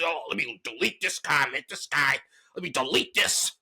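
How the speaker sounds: put-on voice mocking a touchy host; mocking imitation. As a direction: lightly mimic him huffily deleting a comment. Mocking the host deleting comments.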